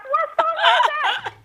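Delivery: high, drawn-out excited question; excited mock squeal. Retelling hearing sisters scream at a song, high excited squeal. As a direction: imitate yourself squealing to find out.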